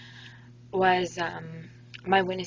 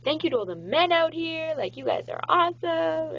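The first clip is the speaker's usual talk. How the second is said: imitating hosts; 10 semitones higher, 5 dB louder than usual. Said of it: "they kept saying".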